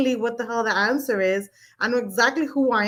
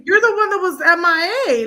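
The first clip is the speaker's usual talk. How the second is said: indignant; 8 semitones higher, 5 dB louder than usual. Real, not acted. Indignant retelling of a fight.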